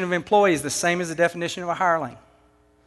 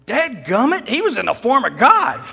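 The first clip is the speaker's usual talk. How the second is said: hammed-up Texas twang, swooping higher and louder; playful Texas accent; 4 semitones higher, 4 dB louder than usual. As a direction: burst out in exasperated Texan. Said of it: "just a little Texas in there".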